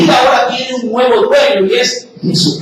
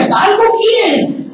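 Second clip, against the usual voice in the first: put-on kids teasing; 10 semitones higher than usual. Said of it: "vienen los muchachos y dicen".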